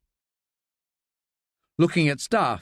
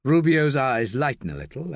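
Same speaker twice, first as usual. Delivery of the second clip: ordinary delivery, nothing marked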